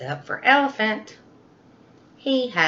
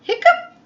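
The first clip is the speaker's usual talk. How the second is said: a sudden high squeaky hiccup sound; loud hiccup; 8 dB louder than usual. Acted. Elephant hiccups, a high loud hiccup. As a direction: pop out a big comic hiccup.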